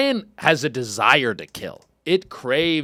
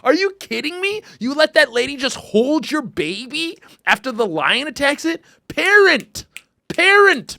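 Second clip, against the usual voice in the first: high-pitched incredulous rant building to a shout; outraged scolding; 11 semitones higher, 4 dB louder than usual. Pretend. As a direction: scold a careless parent in disbelief. Mock scolding the lenient mother.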